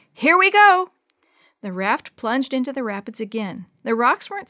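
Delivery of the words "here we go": high, loud whoop; big thrilled yell; excited shout. A narrator's reading. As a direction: shout it as the raft takes off. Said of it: "grabbed the handles tighter and shouted".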